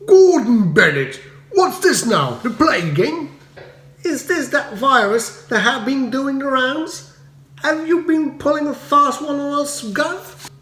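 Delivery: indignant Cockney man accusing the earl; outraged cockney villager. A narrator's reading. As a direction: splutter it at the lord. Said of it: "a choir of expostulation".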